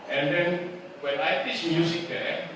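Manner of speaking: normal talking voice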